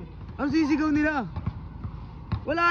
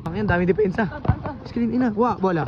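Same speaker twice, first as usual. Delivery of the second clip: usual speaking voice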